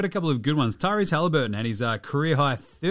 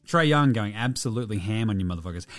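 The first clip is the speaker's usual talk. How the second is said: ordinary talk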